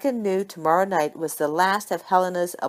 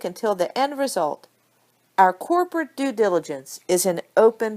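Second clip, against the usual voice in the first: raised, louder keynote address to a ballroom; higher, louder speech delivered from the stage; formal speech; 5 semitones higher, 3 dB louder than usual. Acted. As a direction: project it like a conference speech. Micah delivers her conference speech.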